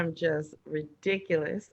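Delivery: ordinary talk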